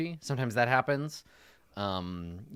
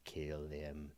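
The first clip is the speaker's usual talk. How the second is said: necromancer voice; 6 semitones lower, 5 dB louder, slower than usual. Retold or imitated. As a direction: whisper a cold order like a necromancer. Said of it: "That's my necromancer impression".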